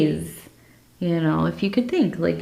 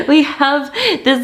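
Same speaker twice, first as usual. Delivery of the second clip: laughing; 7 semitones higher, 3 dB louder than usual. Real, not acted. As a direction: laugh it out, amused. Laughs at the dove image.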